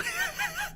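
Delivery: a sudden squeaky burst of laughter; high squeaky laugh. Real, not acted. Reading his texts aloud to viewers, laughing high.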